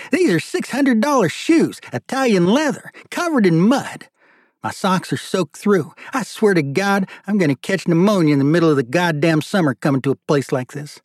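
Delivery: milk every grievance; indignant whining. Acted. Marty complains about ruined shoes.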